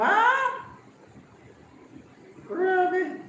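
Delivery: high-pitched wow of disbelief; high, astonished outburst; amazed exclamation. Real, not acted. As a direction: react in astonishment, pitch shooting up. Amazed at cost of education.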